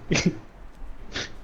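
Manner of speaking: voiceless, quiet laugh; silent breathy laugh. Real, not acted.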